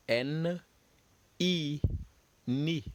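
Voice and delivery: N, A, "na" spelled then said as a syllable; French syllable drill. Shown as a demonstration. Teacher models French syllables for students to repeat. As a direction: sound out N, A and then "na" slowly for the class. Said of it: "Repeat after me".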